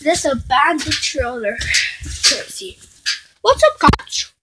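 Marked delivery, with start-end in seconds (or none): 3.4-4.3 high playful character voice; squeaky pretend voice. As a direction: voice a tiny cop greeting. Kids greeting toys in play.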